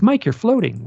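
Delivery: a retold line in a light German accent. Retelling his friend calling out his name in a light German accent. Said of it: "with her German accent".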